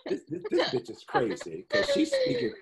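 words spilling out through a high laugh. Real, not acted. Laughing to her hosts about being crazy, giggling through it. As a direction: laugh while you speak, pitch way up.

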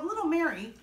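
plain reading voice

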